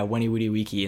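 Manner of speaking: WENI WEEDY WIKI with W's for V's, offhand; Latin pronunciation. Retold or imitated. Co-host retelling a senior quote, Latin pronunciation. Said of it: "I would pronounce it to them".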